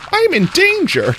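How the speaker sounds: sudden high, loud mock panic; I'm in danger. Going into a cave in the game, yelping in mock panic. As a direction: squeal it like a meme in mock alarm. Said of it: "I switched from sultry ... to I'm in danger".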